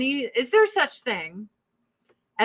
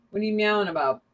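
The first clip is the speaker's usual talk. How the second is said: ordinary talk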